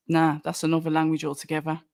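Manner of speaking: ordinary talk, nothing marked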